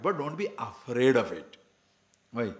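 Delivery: ordinary talk, nothing marked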